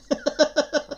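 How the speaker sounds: short high-pitched chuckle; laugh. Real, not acted. Teacher amused by his child beside him, laughing. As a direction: let out a quick, high giggle.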